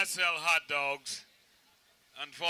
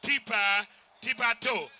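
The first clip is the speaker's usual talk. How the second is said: higher-pitched vendor call, imitated; Japanese vendors' cry; 5 semitones higher, 4 dB louder, slower than usual. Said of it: "they run up and down and say".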